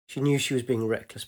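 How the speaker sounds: plain reading voice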